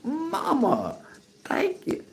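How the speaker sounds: put-on high thrilled child's voice thanking mama; childlike thanks. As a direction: play a kid thanking mama on Christmas morning. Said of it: "you go".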